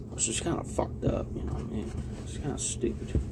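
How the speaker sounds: quick whispered aside. Real, not acted. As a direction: drop to a whisper for the comment. Whispered aside after the whiskey point.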